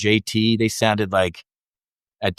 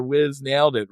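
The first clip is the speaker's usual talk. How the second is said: ordinary talk, nothing marked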